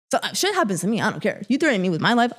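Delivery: ordinary talk, nothing marked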